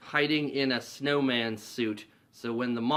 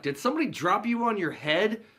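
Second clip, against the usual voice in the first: higher, scornful jab; mocking put-down; 9 semitones higher than usual. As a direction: sneer at the kid. [name] mocks the kid.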